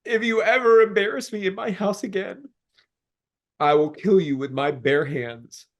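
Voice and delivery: high tearful voice, breaking; crying. Real, not acted. Man tearfully recalling his uncle's threat. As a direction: fight through tears while telling it. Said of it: "I started crying harder".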